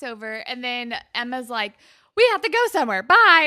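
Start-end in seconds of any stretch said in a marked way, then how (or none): 2.1-3.4 loud, high mock holler; retold urgent outburst. Emma pulling Liberty away to give them space, urgent shout. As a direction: blurt it loudly to hustle everyone off.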